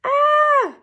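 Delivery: put-on high, loud kid's shriek; shrill-pitched full-voiced scream; child's scream. A narrator's reading. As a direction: scream out like a startled little kid. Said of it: "the kid screamed".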